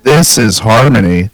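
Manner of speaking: stately name drop; harmony. Pretend. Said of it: "That's harmony?".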